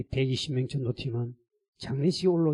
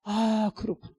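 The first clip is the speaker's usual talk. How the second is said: higher-pitched moment of realisation; dawning realisation; 7 semitones higher, slower than usual. Retold or imitated. Pastor grasping God's rebuke, dawning realisation. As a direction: let the truth sink in.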